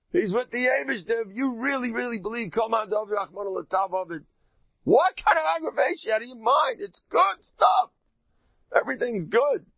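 high, loud, elated preaching; exuberant faith. Acted. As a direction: burst out with joyful conviction, voice climbing high. Preacher voices joyful, exuberant faith.

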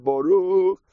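a lightly voiced old rabbi's fervent blessing; imitating fervent prayer. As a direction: slowly intone it like a devout elder at prayer. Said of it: "the way he davened Shemoneh Esrei".

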